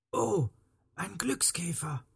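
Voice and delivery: high "oh" sinking to a whisper; murmured. A narrator's reading. Lonely hurt man noticing a lucky ladybird, hushed murmur. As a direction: breathe it in hushed wonder. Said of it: "murmelte der arme Herr Meyer".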